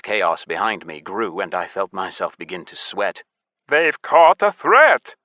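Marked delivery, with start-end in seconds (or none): 3.7-5.1 slow, high, loud amazement; gasped surprise. A narrator's reading. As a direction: exclaim startling news to the crowd. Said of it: "suddenly gasped in surprise".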